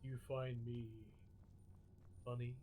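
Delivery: pushed-low radio voice; as deep and manly as you can. Pretend. Streamer doing the Silky Smooth redemption for chat, deep and manly. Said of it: "I have to talk like this for five minutes".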